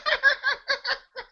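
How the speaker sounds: high-pitched laughing fit. Real, not acted. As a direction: burst out laughing. Laughing after the girl quote.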